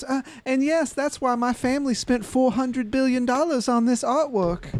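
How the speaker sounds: a hint of a haughty princess; lightly voiced noble lady. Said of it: "you overhear the princess".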